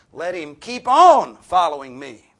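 loud, slow, ringing proclamation; forceful command. Acted. Preacher booming Jesus' call to the congregation, loud and slow.

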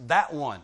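THAT punched loud; very emphatic. Shown as a demonstration. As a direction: point hard with THAT. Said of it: "a very emphatic way to say it".

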